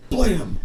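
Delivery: loud high "Blam!" mimicking a sudden smack; blam sound effect. Retelling the pan shot, a sharp high blam. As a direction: bark out the impact noise. Imitating the pizza pan hit.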